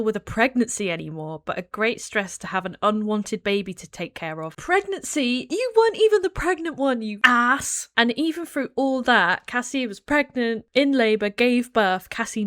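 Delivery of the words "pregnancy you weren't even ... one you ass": indignant scoff. Real, not acted. Scoffing at Don's complaint.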